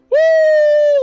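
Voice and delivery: long, high, loud "woo"; triumphant whoop. Real, not acted. Player celebrating new superpowers, triumphant whoop. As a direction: whoop at full volume in celebration.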